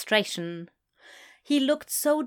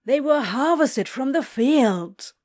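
slow, louder whiny insistence; whining; 5 semitones higher, 5 dB louder, slower than usual. Acted. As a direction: keep protesting, higher and drawn out. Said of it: "whined Crowley".

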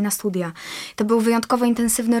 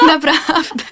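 laughing; 6 semitones higher, 7 dB louder, slower than usual. Real, not acted. Laughing after her own joke.

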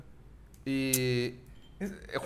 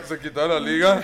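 normal talking voice

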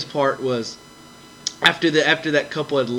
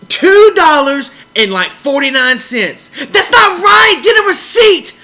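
amazed outcry over a tiny sum; incredulous; 12 semitones higher, 8 dB louder than usual. Real, not acted. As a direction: shout the price in disbelief. Outrage at the small amount.

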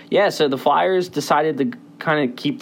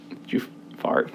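whisper; 8 dB quieter than usual. Real, not acted. Whispered joke aside to cohost.

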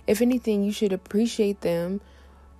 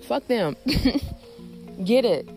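defiant; 4 semitones higher than usual. Real, not acted. Defiant punchline, then laugh.

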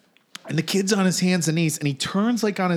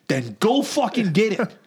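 ordinary delivery

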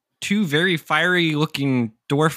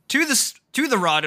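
higher urgent dwarf voice; 11 semitones higher than usual. Acted. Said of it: "And he's like".

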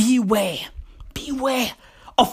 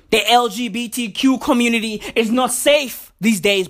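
normal talking voice

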